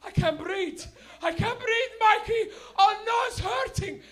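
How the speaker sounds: very high, loud mock wailing; panicked crying father. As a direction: scream as if the mouth is on fire. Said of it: "he started crying".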